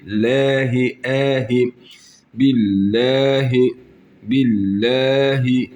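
billahi with a light thin l, lahi near læhi; thin lam. Shown as a demonstration. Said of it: "lam harfini ince okuyacağız". (billahi ince okunuşla)

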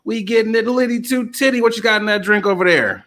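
high-pitched, rolling host patter; high, lively announcer voice; hype show intro. Acted. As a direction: keep the hype up like an MC.

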